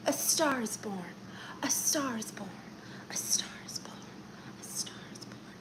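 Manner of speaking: whispered chant fading to silence. Shown as a demonstration. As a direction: whisper it away to nothing. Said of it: "whispered repeats fading out".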